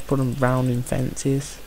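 ordinary delivery

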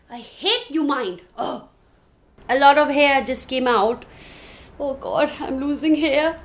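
ordinary talk, nothing marked

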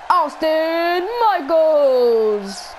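very slow, stretched-out name call; ring announcer voice. Pretend. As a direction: draw the name out for the crowd. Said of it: "That was a little weird right there".

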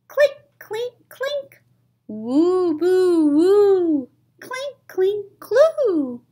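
high, loud sing-song clinks and woos; eerie clink-clink sound effects; spooky clinking noises. Acted. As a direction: chant the ghostly sounds up high.

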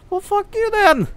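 a high squeaky fuck-you then; mock squeaky retort. Streamer mocking the game in a high squeaky voice. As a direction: toss it off in a squeaky put-on voice.

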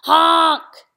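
very loud, drawn-out honk; yelled truck honk. A narrator's reading. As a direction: blare it like a big truck horn. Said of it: "yelled the dump truck".

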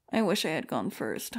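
usual speaking voice

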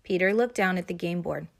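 plain reading voice

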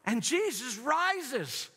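high, slow storytelling preacher; dramatic preaching. Acted. Preacher dramatizing the supper scene.

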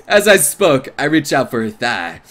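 usual speaking voice

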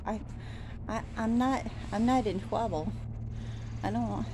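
ordinary talk, nothing marked

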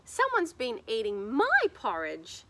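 usual speaking voice

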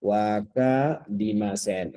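Wakarimasen drawn out slowly, syllable by syllable; slow model. Shown as a demonstration. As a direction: stretch Wakarimasen for the class to copy. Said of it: "les voy a dar una palabra importante". (Wakarimasen despacio, sílaba por sílaba)

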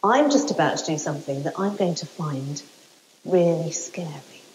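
low, hushed confiding voice; hushed apprehension. Real, not acted. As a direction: drop almost to a whisper before the scary bit. About to face her fear.

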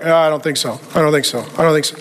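a hint of gruff dismissal; dismissive guys. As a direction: brush it off like stubborn men. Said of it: "they're like".

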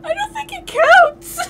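very high laughing squeal. Real, not acted.

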